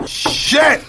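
shocked exclamation. Real, not acted. High exclaim at liposuction.

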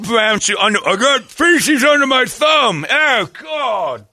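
high, hammed-up rocker shriek; Jagger. Orderly Jagger with feces under his thumb, high mock-disgusted shout. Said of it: "Orderly Jagger".

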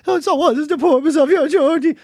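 high put-on official question; mock-official singsong query; young guard. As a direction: imitate an eager young guard at a checkpoint. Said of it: "they're so young".

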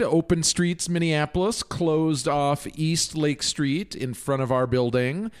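ordinary talk

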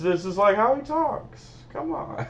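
hammed-up Christopher Walken; Christopher Walken impression. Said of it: "He doesn't sound like Christopher Walken".

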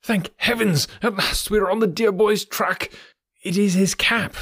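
put-on a fretful old doctor who has finally found hope; Doctor Huxtable showing the cap, high excited relief; overjoyed headmaster. A narrator's reading. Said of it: "he cried".

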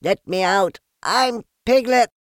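high, loud squeal; squeaky piglet crying out. A narrator's reading. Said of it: "cried Piglet".

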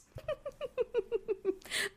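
a burst of real high laughter; high-pitched laugh. Real, not acted. Reading her old post, bursts into high laughter.

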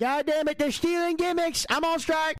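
high-pitched indignant protest; pissed off. Real, not acted. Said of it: "I'm too pissed off".

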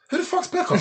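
can't believe what he's hearing; incredulous. Retold or imitated. Incredulous retold reaction.

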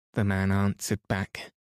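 plain reading voice

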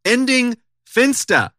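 slow, high, quoting the phrase; quoted phrase. Retold or imitated. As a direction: set the words apart in air quotes. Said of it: "quote unquote".

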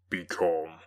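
a bitter creature, deep and heavy; the demon. A narrator's reading. The creature answering his creator, low and heavy. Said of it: "He easily eluded me and said".

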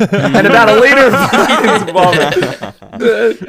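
slow punchline, laughing through "liter". Real, not acted. Telling friends about the vomit, laughing loudly. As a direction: laugh it out while saying it.

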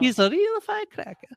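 high, put-on catchphrase delivery. Host's running joke about Ridley Scott, said in a high put-on voice. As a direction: squeak it out like a running gag. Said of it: "you know what I say about Ridley Scott all the time".

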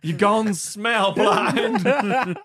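high, very slow, laughing mother voice; mother declaring it, hammed up and laughing; mock mother. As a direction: drag out each word like a stern mama. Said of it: "It's like".

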